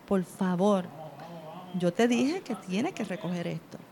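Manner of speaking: soft, low, firm reminder; close and quiet. Shown as a demonstration. Parent up close reminding child, quiet and firm. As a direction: lean in and say it quietly but firmly. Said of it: "te le vas más cerca, lo miras".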